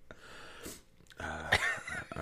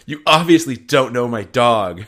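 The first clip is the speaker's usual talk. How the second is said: louder, slowed, laughing; mock yell; 12 dB louder than usual. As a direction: huff it indignantly. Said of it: "she probably just yells".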